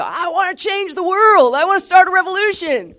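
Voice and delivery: high, loud mock battle cry; rallying shout. Preacher acting out a believer's urge to change the world, loud shout. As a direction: shout it out like a fired-up revolutionary. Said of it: "you see the world and you just go".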